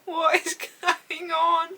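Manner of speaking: high, very drawn-out wail; flustered disbelief. Real, not acted. Reader flashing the camera by accident, flustered disbelief. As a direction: stretch it out, overcome and giddy.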